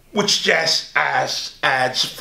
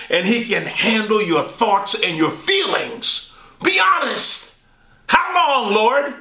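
pious saint; 7 semitones higher than usual. Acted. Pious saint scolding, then urging honesty.

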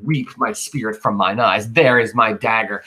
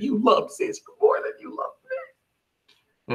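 much higher, slow, whiny jealous complaint; jealous mock whine; 4 dB quieter, slower than usual. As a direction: play a sulky jealous friend. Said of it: "He just said".

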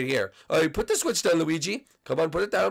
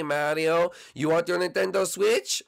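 ordinary talk

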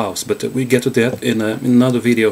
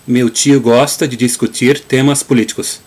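Gaúcho accent. Shown as a demonstration. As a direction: say it like a Gaúcho from the south. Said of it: "One more time".

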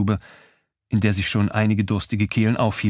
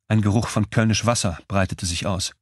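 ordinary delivery, nothing marked

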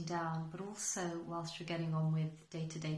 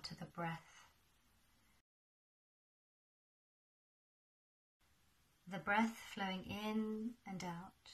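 unhurried guide with long pauses between phrases; guided meditation. Acted. Teacher guiding listeners to settle into their breath, guided meditation. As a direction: lead them gently through a breathing exercise.

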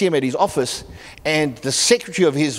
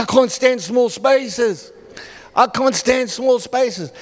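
higher, slow, strained panic; panicky woman; 5 semitones higher, much slower than usual. Retold or imitated. Claustrophobic woman in the lift telling the pastor, panicked. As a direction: plead to get out, voice tight.

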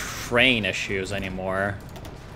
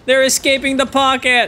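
high, raised frantic report; exasperated alarm; 12 semitones higher, 4 dB louder than usual. Real, not acted. Gamer watching enemy escape the pocket, frantic. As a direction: call it out in dismay.

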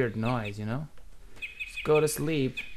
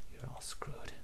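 softer, eerie unvoiced whisper; creepy whisper; 13 dB quieter than usual. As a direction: breathe the threat like a ghost. Ghostly whisper in game.

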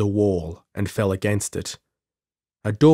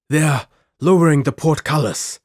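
higher, louder urgent cry; strained croak; 6 semitones higher, 5 dB louder than usual. A narrator's reading. Exhausted Gordon warning his companion of the portcullis, croaked cry. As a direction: croak out an alarmed warning, pitched up. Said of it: "Gordon croaked".

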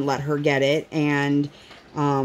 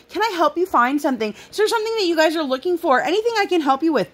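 eager employee; 11 semitones higher, faster than usual. Retold or imitated. Mocking eager store employee.

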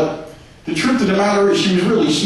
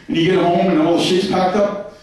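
ordinary talk, nothing marked

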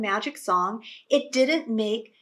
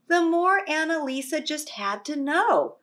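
plain reading voice

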